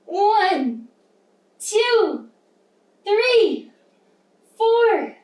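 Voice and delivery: loud, high, falling swoop on each number; emphatic bite counting. Pretend. Teacher taking five bites with children, exuberant spaced counting. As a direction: punch out every number like a big chomp.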